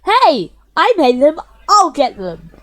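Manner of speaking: loud, snappy protest; indignant girl. Acted. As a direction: cut in, offended. Natsuki objects sharply.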